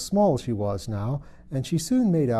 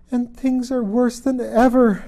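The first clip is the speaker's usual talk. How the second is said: put-on high, loud childish lament, slowed; a despairing child crying out, higher and louder; wailing Alice, much higher, slow; 11 semitones higher, 7 dB louder than usual. A narrator's reading. Alice voiced in read story.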